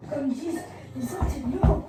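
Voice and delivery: sudden loud burst; loud outburst. Real, not acted. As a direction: blurt it out loud. Gamer raging at the game. (éclat de voix)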